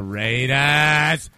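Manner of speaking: loud, slow build on the team name; stretched, half-sung proclamation; drawn-out announcer shout. Pretend. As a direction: bellow the pick like a hammed-up announcer.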